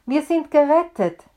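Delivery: high, loud joyful cry; shouted. A narrator's reading. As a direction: burst in shouting the good news. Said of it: "rief er".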